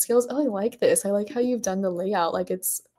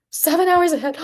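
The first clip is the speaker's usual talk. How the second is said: high, louder, startled; shocked realization; 9 semitones higher, 5 dB louder than usual. Real, not acted. Streamer learning the viewer's time zone, shocked. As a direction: blurt it high in disbelief. Realizes how late it is there.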